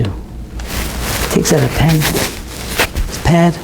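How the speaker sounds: soft, slow, breathy near-whisper; quiet slowed narration; hushed aside. A narrator's reading. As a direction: drop almost to a whisper for the aside.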